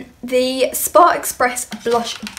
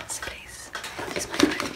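hushed, joking plea; mock pleading whisper; 12 dB quieter than usual. Vlogger jokingly begging viewers to use her code, whispered. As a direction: whisper it as a pretend beg.

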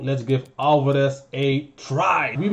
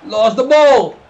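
high, loud yell of frustration; frustrated shout; 7 semitones higher, 10 dB louder, slower than usual. Real, not acted. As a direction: yell at the screen. Gaming, chance wasted.

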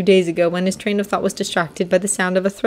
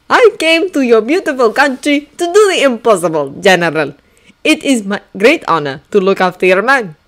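put-on higher, louder eager young officer; eager Frenchman; 5 semitones higher, 5 dB louder than usual. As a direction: plead keenly as a French officer. Lafayette voiced in story.

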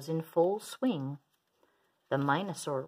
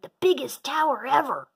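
high, loud boast; shouting; 8 semitones higher, 7 dB louder than usual. A narrator's reading. Minosaur bragging to an empty yard, shouting. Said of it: "he shouted".

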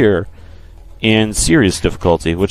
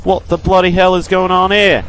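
higher, hammed-up British accent; mock British accent; 7 semitones higher, faster than usual. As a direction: play a jokey Brit cursing the enemies. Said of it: "That just sounded British".

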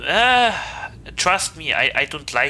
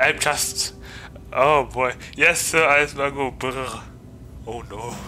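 ordinary talk, nothing marked

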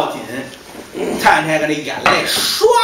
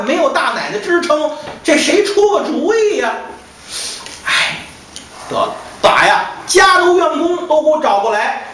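higher, lamenting, at a loss; lamenting master; 8 semitones higher than usual. Acted. Master laments to gardener Gao, at a loss. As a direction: wail that he doesn't know what to do.

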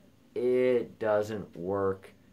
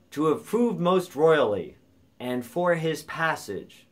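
declaimed verse line; 7 semitones higher, 6 dB louder than usual. Shown as a demonstration. Teacher recites verse, counting syllables.

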